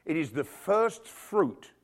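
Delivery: slow, weighty first fruit; slowed, deliberate emphasis. Shown as a demonstration. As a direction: lean on each word to make it sink in. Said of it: "slowed emphasis".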